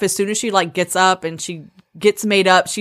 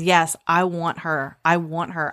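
quiet realization; 5 semitones lower, 5 dB quieter, slower than usual. Retold or imitated. She realizes she wants Waylay.

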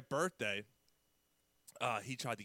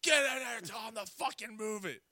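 much higher, loud mock bark; bossy order; 10 semitones higher, 5 dB louder than usual. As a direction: order him out like an angry boss. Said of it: "I said".

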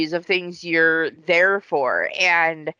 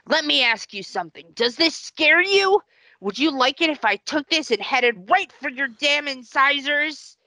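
sadistic dentist; 9 semitones higher than usual. Retold or imitated. Dentist taunting terrified patient.

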